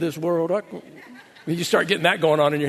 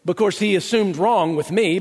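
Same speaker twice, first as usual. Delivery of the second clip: ordinary delivery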